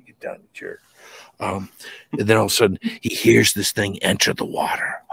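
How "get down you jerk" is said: low, soft grumble; real aside, grumbled under the breath; muttered scolding. Real, not acted. Telling his dog off mid-story, muttering low.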